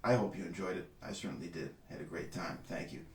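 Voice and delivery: hushed, low-pitched sign-off; quiet aside. Real, not acted. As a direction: drop into a low, soft personal aside. Mock-personal aside correcting the "we.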